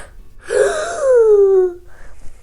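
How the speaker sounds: sharp frightened intake of breath; shocked gasp. Acted. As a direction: gasp as if something is at the window. Princess sees frog at window.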